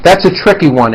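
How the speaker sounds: ordinary talk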